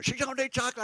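prim, mock disapproving daughters; daughters scolding. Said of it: "the daughters are going".

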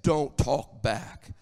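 low, very slow dad voice; deep baritone. Acted. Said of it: "it's baritone in the old hymn".

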